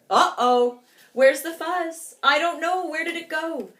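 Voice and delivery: high, loud worried cries; a prairie dog waking to find the fuzz gone; alarmed. Acted. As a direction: panic about the missing fuzz.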